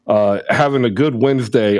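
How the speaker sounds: ordinary talk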